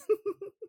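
brief high laugh, emotional; short emotional laugh. Real, not acted. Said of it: "I'm getting emotional".

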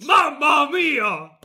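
slow, hammed-up Italian monster accent; Bigfoot. Shown as a demonstration. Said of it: "Monsters and Italians have the same accent".

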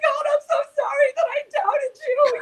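high, teary laugh breaking through the words; laughing through tears. Real, not acted. Preacher overcome, apologizing to God, laughing and crying. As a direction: let real tears and a laugh spill out together. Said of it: "I'm having my own little emotional moment".

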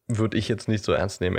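ordinary talk, nothing marked

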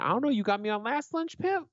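mock him in a high whiny pitch. Friend mocking a buddy who skipped paying for lunch, high squeaky taunt.

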